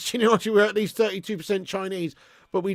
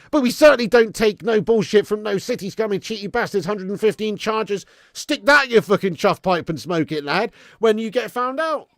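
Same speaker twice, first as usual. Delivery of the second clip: loud, raised-pitch tirade at rival fans; fired-up rant; 5 semitones higher, 7 dB louder than usual. Real, not acted. Host hitting back at City fans over the charges, fired-up rant. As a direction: let rip with heated football banter.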